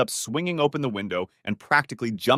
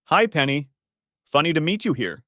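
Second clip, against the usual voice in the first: with aplomb; 5 semitones higher, 4 dB louder than usual. A narrator's reading. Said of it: "he said with aplomb".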